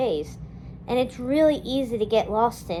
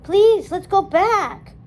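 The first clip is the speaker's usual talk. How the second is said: high, loud, slow plea; pleading; 8 semitones higher, 7 dB louder, slower than usual. A narrator's reading. Dawn begging Felipa in the rain, slow loud plea. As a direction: beg her to come back.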